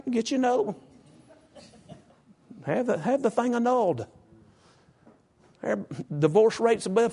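high, flippant parody of bad counsel; mocking worldly advice. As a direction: toss off careless advice mockingly.

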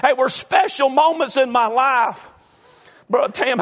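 ordinary talk, nothing marked

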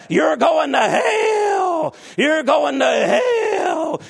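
slow, drawn-out hellfire shout, high and loud; imitating a shouting preacher. Mimicking the hellfire preacher to the church, slow shout. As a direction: bellow each word like a damning preacher. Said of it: "Uh, he said".

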